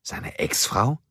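high, sharply rising question; surprised. A narrator's reading. Kim learns it is the boss's ex-wife, surprised. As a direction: lift the last word in surprise. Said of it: "fragte Kim überrascht".

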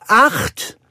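high, loud, falling call; excited announcement. A narrator's reading. Nanny calls out the puppy count. (aufgeregt verkündend)

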